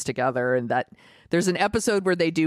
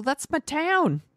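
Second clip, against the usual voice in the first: high, drawn-out mock boast; imitated hometown pride; 11 semitones higher, slower than usual. Teasing the friend who saw their corner, high mock boast. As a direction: draw it out high, proudly. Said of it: "You're all".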